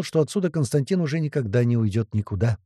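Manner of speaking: plain reading voice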